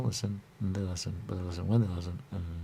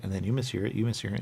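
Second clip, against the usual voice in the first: ordinary talk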